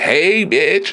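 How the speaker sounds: high, campy mock greeting; sashay in with a sassy hello; flamboyant greeting. Man imitating a flamboyant entrance, in a high campy voice. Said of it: "If I came in all flamboyant".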